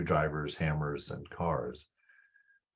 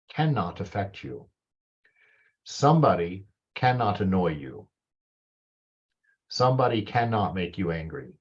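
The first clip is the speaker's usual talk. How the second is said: usual speaking voice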